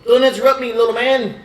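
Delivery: high, loud snap; indignant scold. Acted. Elder scolding the player who interrupted, indignant. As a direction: put the little man in his place.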